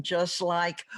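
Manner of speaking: normal talking voice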